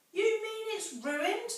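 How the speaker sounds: very high, slow dismay; dismayed surprise. Acted. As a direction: let the disappointment lift the pitch. Mabel dismayed about the tail.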